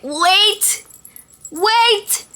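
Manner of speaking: high, loud cry of "wait"; panicked call. Retold or imitated. Patient stopping staff with gas in face, panicked call. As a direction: call out to halt them, high and urgent.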